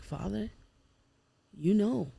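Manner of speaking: retold prayer, softly under the breath. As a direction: pray under your breath, surrendering. Retold quiet prayer in truck.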